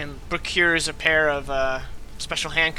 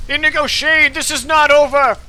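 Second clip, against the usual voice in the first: higher and louder parting threat; vengeful shout; 9 semitones higher, 5 dB louder than usual. Acted. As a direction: bellow it as he's dragged off. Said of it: "he shouts".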